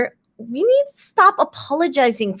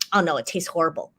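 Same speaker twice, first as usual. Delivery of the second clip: quick, lower, softer brush-off; dismissive modesty; 4 semitones lower, 6 dB quieter, faster than usual. Acted. Cook turning down praise for a meal, dismissive. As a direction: wave the compliment away fast and low. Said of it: "looks like being dismissive".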